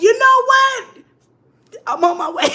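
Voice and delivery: bright, high, loud mock-cheery "on my way"; fake cheerful reassurance. Texting her date a cheery lie, high and loud. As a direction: sell the lie with a chirpy shout.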